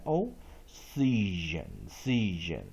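slow, drawn-out "season"; slow teacher demo; slow modelling. Shown as a demonstration. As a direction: stretch "season" to show the weak O. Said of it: "所以念she，season".

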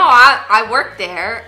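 normal talking voice

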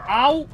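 sudden high loud ouch; real sharp cry of pain; pained yelp. Real, not acted. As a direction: cry out as you get hit. Gets hit in game.

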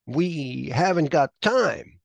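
mock urgency, dragging the words out; Kirk urgent. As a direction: Kirk tells Spock there's no time, loud and slow. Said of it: "he said".

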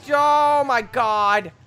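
high, loud, drawn-out cry; loud outcry. Real, not acted. Gamer yelling at a game mishap, loud outcry.

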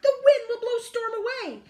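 high, loud proclamation; excited bark. A narrator's reading. Dog cheering up the frightened animals, barking. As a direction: bark it out triumphantly. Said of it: "Dog barked".

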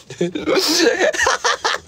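real high-pitched laughing fit; high giggling laugh. Real, not acted. Amused after praising a friend, high giggling laugh. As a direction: crack up in high bursts.

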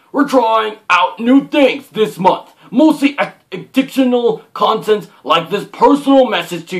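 theatrical announcer-like reading with swooping pitch; hammed-up read-aloud. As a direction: read it out with big dramatic emphasis. Reads the printed welcome note aloud.

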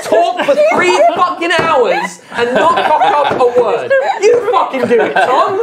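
half-laughing mock outrage, high; laughing rant. Real, not acted. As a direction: rant at him through laughter.

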